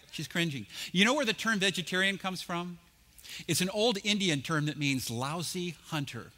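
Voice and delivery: ordinary talk, nothing marked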